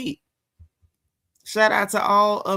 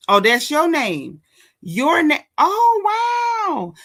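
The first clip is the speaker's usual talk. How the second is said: high, loud surprised outcry; 6 semitones higher, 5 dB louder, much slower than usual. Real, not acted. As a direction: react with a surprised wow. Surprised on reading a name.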